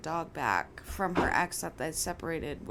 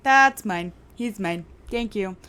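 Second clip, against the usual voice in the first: sassy claim; 2 semitones higher, 10 dB louder than usual. Retold or imitated. Claiming dog from ex.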